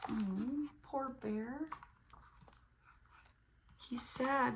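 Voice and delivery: soft pitying aw; gently sorry for him; sympathetic. Acted. Pitying the sad bear.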